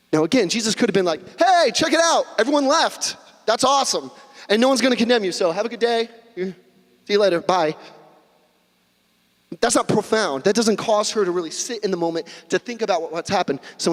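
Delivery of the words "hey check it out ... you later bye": higher-pitched casual cheer; breezy, upbeat. Pretend. Preacher imagining a casual Jesus dismissing the woman, breezy and upbeat. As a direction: toss it off brightly like a flippant goodbye.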